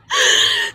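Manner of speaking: very high squealing laugh. Real, not acted. Amused by a viewer's joke, squealing laugh.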